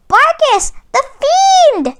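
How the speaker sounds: high, loud outraged cry; Sly blames his cousin Barkus; cried out. Acted. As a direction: bellow the name in fury. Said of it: "he cried".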